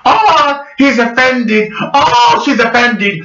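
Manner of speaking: mock fake outrage in a high voice; mocking the offended. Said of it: "by pretending".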